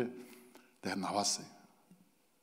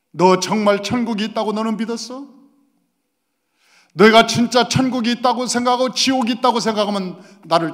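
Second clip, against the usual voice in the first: loud, high, pounding rebuke; fiery preaching rebuke; 7 semitones higher, 10 dB louder than usual. Acted. The Holy Spirit rebukes the pastor, voiced as a loud preached cry. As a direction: hammer the question home at full force.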